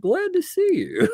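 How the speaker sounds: squeaky high-pitched quote; high mock voice. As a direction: squeak it out jokingly. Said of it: "quote unquote".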